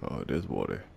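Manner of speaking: ordinary delivery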